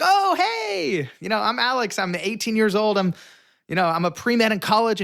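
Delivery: chirpy, trying hard to sound likeable; eager young self. Retold or imitated. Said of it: "And I'm like".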